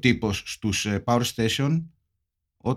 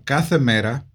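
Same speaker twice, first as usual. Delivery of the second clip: ordinary talk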